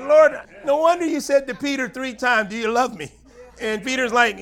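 high, loud, fervent preaching; full-voiced sermon climax; preaching at full force. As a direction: lift the whole passage, pleading and urgent. Preaching at full force, retelling Peter.

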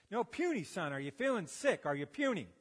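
a hint of a gruff Arkansas coach. Basketball coach pressing a student, loud and high.

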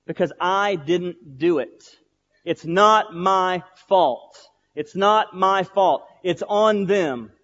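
slow, emphatic denial of blame; defensive refusal. Retold or imitated. Preacher retells refusing God's call to forgive, defensive. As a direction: push every word out slowly, insisting. Acting out his refusal to God.

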